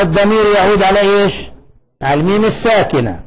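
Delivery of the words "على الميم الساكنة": ordinary talk, nothing marked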